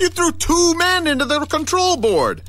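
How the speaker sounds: frightened friend confronting the man who threw two men; alarmed. Acted. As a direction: plead in alarm.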